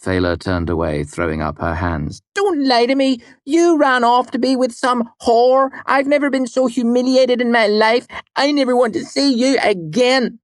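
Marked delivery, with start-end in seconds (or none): 2.3-10.4 high, loud hammed-up fury; mock outrage. Fela playing the humiliated lover, hammed-up fury. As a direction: storm at him like a scorned lover. Fela acting the scorned woman.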